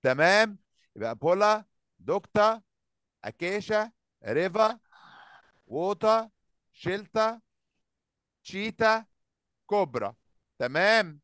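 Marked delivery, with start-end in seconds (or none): 1.1-10.1 each word ends on an open a, "دكتور" as dokta and "شيلتا" as shelta; endings all as a. Shown as a demonstration. Teacher reviews word list for pupils, all ending in a. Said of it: "أكنها أ، مش أو".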